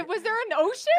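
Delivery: high, loud teasing teacher question; mock teacher. Friend teasing host about teacher, high loud mock question. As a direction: squeal the teacher's question for laughs.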